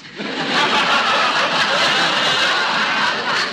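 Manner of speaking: loud high laugh; husband roaring at his own gag, loud; hearty laugh. Acted. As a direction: laugh big at your own joke. Nick laughs loudly at own joke.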